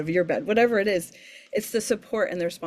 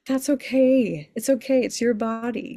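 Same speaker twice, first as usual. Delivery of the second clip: emphatic reassurance; 6 semitones higher, 4 dB louder than usual. Real, not acted. Reassuring a mother about weaning.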